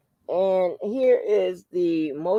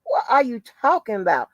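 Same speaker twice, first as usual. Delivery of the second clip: reacting in disbelief to the story; incredulous; 5 semitones higher than usual. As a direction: ask it like you can't believe it. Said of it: "I was like".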